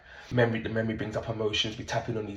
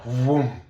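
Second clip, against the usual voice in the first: a loud vroom, like an engine surging; imitated throbbing vroom; 3 semitones higher, 8 dB louder than usual. Coach retelling client's throbbing face, loud vroom.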